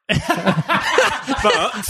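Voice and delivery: high squealing giggle; laughing. Real, not acted. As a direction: break into giggles. Laughing at the bird idea.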